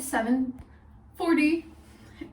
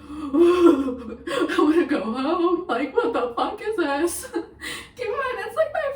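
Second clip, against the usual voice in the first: laugh-crying; 6 semitones higher, 8 dB louder, slower than usual. Retold or imitated. Bawling on garage floor.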